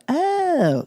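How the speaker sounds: high, drawn-out "oh"; retold realization. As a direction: react as if just corrected. Retold reaction to being corrected.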